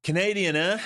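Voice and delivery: a high, loud jokey Canadian "eh"; mock Canadian. Joking to Canadian friends in chat, hammed-up Canadian accent. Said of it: "my friends here are Canadian".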